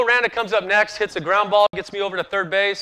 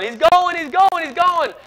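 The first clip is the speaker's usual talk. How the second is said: high, loud alarm shout; teammates yelling; 11 semitones higher, 6 dB louder than usual. Retold or imitated. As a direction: yell the warning across the infield.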